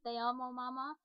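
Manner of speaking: put-on a child's love line for his mother; lightly voiced child; Spanish child voice. Shown as a demonstration.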